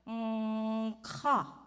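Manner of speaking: held hum opening into "ka"; hum, then release a "ka"; vocal exercise sound; demonstrated "mm-ka". Shown as a demonstration. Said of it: "I want everybody to go".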